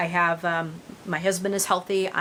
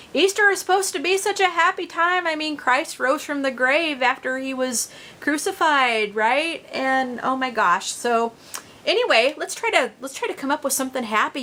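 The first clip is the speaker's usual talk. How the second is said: much higher, bright upbeat push to lift the mood; deliberately cheerful pivot; forced cheer; 6 semitones higher than usual. Real, not acted. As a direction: snap out of the gloom and sound happy. Forcing a cheerful turn.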